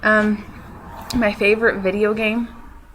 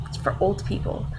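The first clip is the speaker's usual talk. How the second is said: whispered aside; whispered jab; 4 dB quieter than usual. Retold or imitated. Classmate dismissing the show as old, in a whisper. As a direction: murmur it as a teasing put-down.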